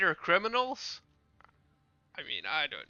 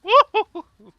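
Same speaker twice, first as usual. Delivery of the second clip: high, loud whooping cheer; excited whoop; 17 dB louder than usual. Real, not acted. Thrilled whoop during a game.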